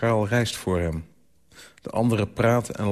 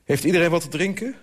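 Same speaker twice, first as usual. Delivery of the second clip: higher, quick call across the table; raised, hurried host's call; 9 semitones higher, faster than usual. A narrator's reading. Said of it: "roept schele Lou".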